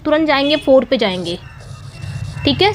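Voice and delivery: normal talking voice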